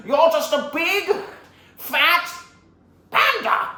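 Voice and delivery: loud, high, word-by-word insult; hammed-up enraged villain; acted villain contempt. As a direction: shout it slowly with big pauses.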